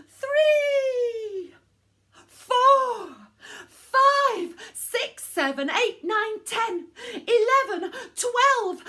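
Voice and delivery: high, loud straining count with puffing; straining puffs. Acted. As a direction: push each number out like blowing hard. Wolf huffing twenty puffs.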